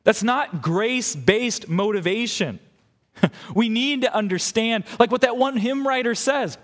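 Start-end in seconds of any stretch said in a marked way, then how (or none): none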